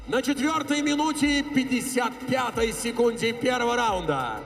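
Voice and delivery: normal talking voice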